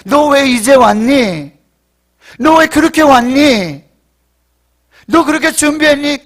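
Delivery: loud, high scolding questions; reproachful questioning. Acted. Preacher voicing the God who does not ask this, reproachful.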